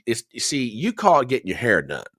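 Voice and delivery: ordinary delivery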